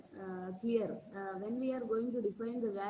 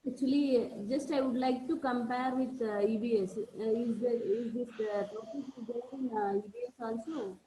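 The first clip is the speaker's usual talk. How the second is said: louder, projecting to be heard; speaking up louder; 5 dB louder than usual. Real, not acted. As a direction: raise the voice so everyone hears. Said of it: "Can you please speak a little loud?".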